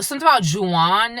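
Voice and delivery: ordinary talk, nothing marked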